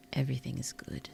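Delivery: soft whispered reassurance. Real, not acted. Reassuring viewers, whispered. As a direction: whisper it gently.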